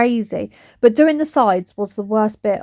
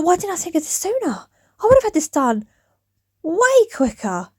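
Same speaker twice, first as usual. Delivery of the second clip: excited self-quote; 7 semitones higher than usual. Retold or imitated. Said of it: "I was like".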